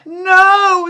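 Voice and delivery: high, loud cry of disbelief; a stunned, rising no; shocked no. Retold or imitated. Teen told she must see judge, shocked loud no.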